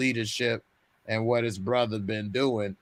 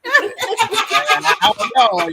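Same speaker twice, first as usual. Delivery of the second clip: high squeal of laughter breaking through; laughing it off, way up high; 7 dB louder, much slower than usual. Real, not acted. Asked if that's his accent, laughing it off. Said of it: "That's how I say it?".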